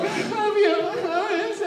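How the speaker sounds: high, tender mock farewell of an old couple; tearful old couple. Kid imitating the couple's last goodbyes, high and tender. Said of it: "the couple next to me is just like".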